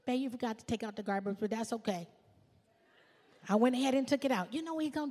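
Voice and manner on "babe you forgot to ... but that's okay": softer and fast, sweetly letting it slide; breezy and loving, no nagging at all; wife gently mentions the garbage to her husband, soft and sweet; sweet, easygoing wife. Pretend. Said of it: "if he came home and I was like".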